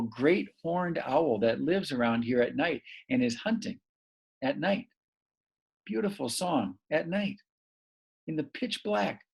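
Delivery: soft, hushed telling; hushed wonder. Real, not acted. Describing owl hunting at night.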